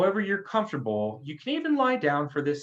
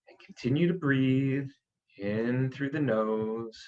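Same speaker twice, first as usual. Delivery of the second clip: guided meditation; 2 semitones higher than usual. Acted. Meditation leader cueing listeners to inhale, hold and exhale, calmly.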